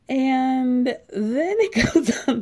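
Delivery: slow, laughing words, half crying; laughing in disbelief. Real, not acted. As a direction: laugh helplessly through the lead-in. Laughing in disbelief while reading.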